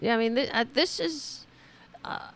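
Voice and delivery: stammering, lost for words; start, falter and restart in disbelief; too stunned to finish a sentence; speechless. Real, not acted. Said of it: "I'm speechless".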